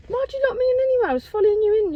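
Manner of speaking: high, fast, loud complaint; indignant. Real, not acted. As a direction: accuse him, exasperated. Arguing with dad.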